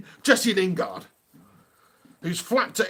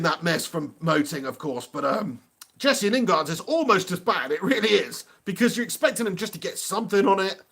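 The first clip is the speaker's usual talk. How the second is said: normal talking voice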